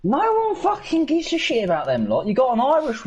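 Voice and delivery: high-pitched, exasperated dismissal; indignant outburst. Real, not acted. Friend dismisses the EU Parliament, indignant. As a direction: burst out scornfully, voice jumping high. Heated retort about EU Parliament.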